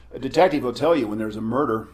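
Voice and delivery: ordinary talk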